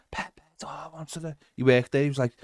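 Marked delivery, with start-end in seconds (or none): 0.1-1.4 a whispered, hushed fan's greeting; imitated whisper. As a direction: whisper it like someone in a cinema. Fan whispering in cinema.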